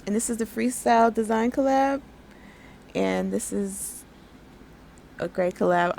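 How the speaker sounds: ordinary delivery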